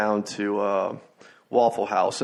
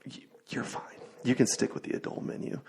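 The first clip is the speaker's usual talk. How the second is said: soft unvoiced hush to a child; consoling whisper; 10 dB quieter than usual. A mom whispering to comfort her son at the table. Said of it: "she had to console her son".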